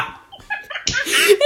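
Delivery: real laugh. Real, not acted. Laughing at the joke.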